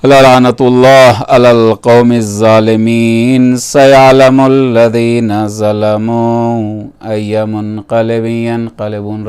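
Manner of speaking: low, slow, drawn-out intoning; Arabic closing recitation. Acted. Preacher reciting the closing curse on the oppressors, slow intoning. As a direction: intone each word at length, softening at the end.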